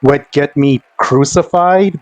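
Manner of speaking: ordinary delivery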